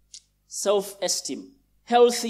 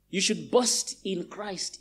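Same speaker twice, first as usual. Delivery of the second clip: ordinary delivery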